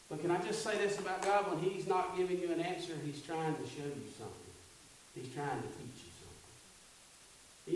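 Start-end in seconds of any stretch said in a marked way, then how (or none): 5.1-7.7 whispered, slow and intimate; softly whispered revelation; hushed whisper. Acted. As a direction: lean in and breathe the line. Repeated line, hushed for effect.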